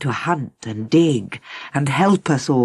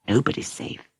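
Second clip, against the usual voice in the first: whispering; 3 semitones lower, 6 dB quieter than usual. Acted. Frightened bird whispers fear.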